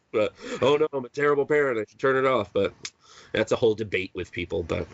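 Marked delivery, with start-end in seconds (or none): 0.6-2.5 lightly mocking, higher and quicker self-accusation; mock dismay. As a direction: play at being the guilty parent, lightly. Mocking critics of his parenting.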